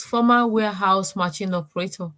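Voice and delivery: ordinary delivery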